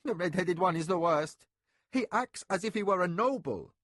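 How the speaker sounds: rushed, high and loud angry complaint. A narrator's reading. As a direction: blurt it out in an angry rush. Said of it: "he finished in an angry rush".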